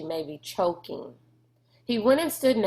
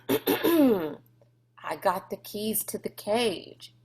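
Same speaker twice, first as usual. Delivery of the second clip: slow, deliberate admission; slow confession; 2 semitones higher, much slower than usual. A narrator's reading. Rob confessing to Sistine he has the keys, slow. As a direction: drag each word out reluctantly.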